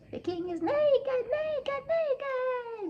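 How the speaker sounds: put-on king copying a child's very high taunt; slow, piercing high squeal on each word; mimicked child's voice. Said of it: "he repeated the vo-, in that voice".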